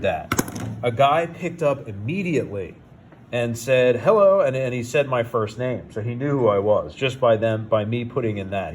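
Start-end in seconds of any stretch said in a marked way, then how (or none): 4.0-4.4 bright loud hello, pitched up; cheery phone greeting. Retold or imitated. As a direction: greet the caller like a salesman. Phone agent answering the call.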